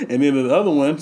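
ordinary delivery

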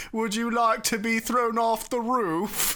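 a touch of mocking; mocking Pharaoh. A narrator's reading. Narrator gives the Pharaoh's line with light mockery. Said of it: "super mockingly".

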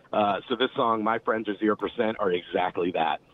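ordinary talk

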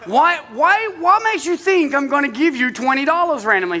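the pastor acts out his outrage at his son with a laugh; mock outrage. Pastor to his son, high laughing mock outrage. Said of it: "and I'm like".

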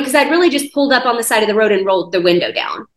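ordinary delivery

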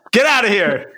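playful shout through laughter; laughing shout. Acted. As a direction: yell it out, laughing. Shooing the raccoon away.

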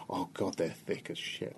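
very soft, under the breath; muttered private thought. Retold or imitated. Comic judging an audience silently, hushed aside. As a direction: murmur it to yourself in dismay.

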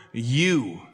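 "you" set apart after a short lead-in; single out "you" as the key word; stress on YOU. Shown as a demonstration. Preacher showing which word the Lord emphasizes, stress on "you". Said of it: "emphasizes the word".

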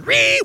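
high shrill Ree screech; mock rage screech. Online troll screeching in mock rage. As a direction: squeal like an enraged troll. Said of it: "and the other one".

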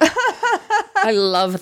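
slow, laughing gush; giggly, drawn-out confession. Real, not acted. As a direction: let the laugh spill into the words. Gushing over Southern accents.

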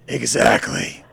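gravelly growled confirmation. Acted. Tattooed attacker answers the frightened girl, growling. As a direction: growl it with menace. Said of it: "Berkey growled".

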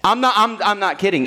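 normal talking voice

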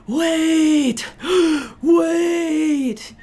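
out of breath, gasping between words. Retold or imitated. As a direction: imitate gasping for air mid-jog. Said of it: "just gasping for breath".

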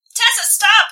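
loud, high-pitched urgent command; acted yell. A narrator's reading. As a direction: yell it out to stop someone leaving. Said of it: "Harry yells again".

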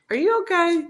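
high, sugary fake concern; mock concern. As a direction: ask it sweetly, with a sting.

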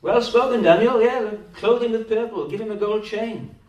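high, loud hammed-up praise; mock flattering courtiers. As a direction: play the fawning court cheering Daniel.

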